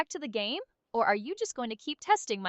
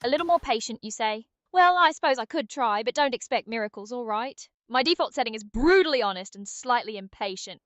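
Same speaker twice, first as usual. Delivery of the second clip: put-on impatient British accent; brisk British nudge to get moving; British Aria. Game sidekick hurrying the player to drop, impatient British. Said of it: "Can British Aria come back for a bit?".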